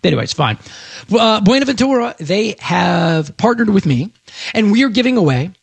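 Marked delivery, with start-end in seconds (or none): none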